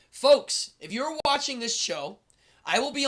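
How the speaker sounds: big, high call to the audience; loud call. Real, not acted. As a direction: belt it out to grab the crowd. Calls out to viewers to announce the show.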